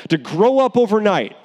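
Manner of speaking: ordinary delivery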